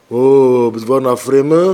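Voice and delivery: loud, drawn-out jeering "oh"; mock taunt. Friend needling a driver who turned religious, mocking. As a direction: tease him as suddenly pious.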